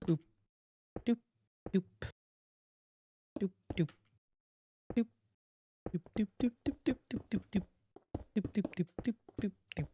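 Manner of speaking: soft, high playful "doop, doop" vocal noises; playful mouth sound effects; singsong doop noises. Real, not acted. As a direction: hum little beeps while building. Humming while placing blocks.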